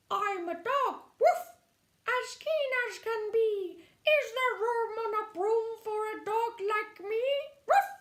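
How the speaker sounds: high, eager dog voice with woofs. Acted. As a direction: bounce in like a keen puppy. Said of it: "eagerly said".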